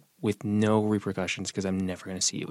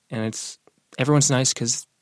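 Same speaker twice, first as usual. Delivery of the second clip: normal talking voice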